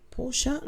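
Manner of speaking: Porsche queried softly as "POR-shuh?"; a quiet, rising Porsche with final "uh"; two-syllable Porsche. Shown as a demonstration. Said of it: "Two syllables".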